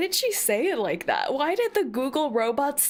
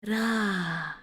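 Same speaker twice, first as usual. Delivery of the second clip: low, airy mock-sultry echo; drawn-out, sultry parody of a robot; mock sexy; 5 semitones lower than usual. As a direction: imitate the translator robot being oddly sexy. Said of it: "Why did she say it so sexily".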